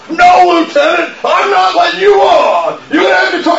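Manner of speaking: screaming at an officer. Retold or imitated. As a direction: shout him down, refusing to let him on. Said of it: "I used to scream at people".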